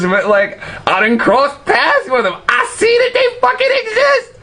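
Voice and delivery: getting giddy and squeaky about it; giddy high laughing rant; excited. Real, not acted. Excited about his own encounters.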